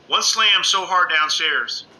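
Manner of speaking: usual speaking voice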